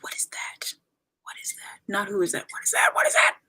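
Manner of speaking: hushed, breath-only disbelief; soft mock-shocked whisper; retold baffled whisper. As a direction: whisper it as if stunned by what you saw. Retells baffled first reaction to idol.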